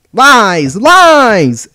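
high, very loud scream, building. A narrator's reading. The controller rejects Deziro's excuse, screaming in outrage. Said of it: "screamed the lolly".

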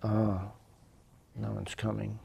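worried inner part. Retold or imitated. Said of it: "my parts were going".

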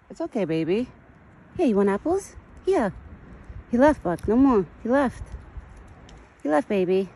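high, sweet voice calming a scared deer; soothing pet talk. Acted. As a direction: coo to the frightened deer. Coaxing the deer with apples.

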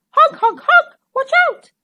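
high, loud honking goose cry. A narrator's reading. Goose warns Hen, high loud honking cry. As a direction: honk it out like a frantic goose. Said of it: "cried Goose".

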